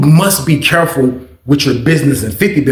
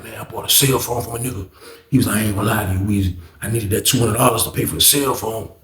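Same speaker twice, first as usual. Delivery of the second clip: low, much softer confiding voice; hushed confession; 8 semitones lower, 9 dB quieter than usual. Retold or imitated. Friend quietly admitting he needed money for a phone. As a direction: confess it under your breath.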